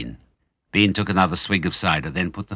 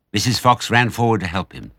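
usual speaking voice

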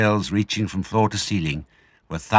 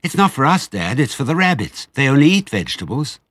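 put-on high, loud little child's voice; small fox child; 7 semitones higher, 5 dB louder than usual. A narrator's reading. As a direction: pipe up eagerly like a small cub.

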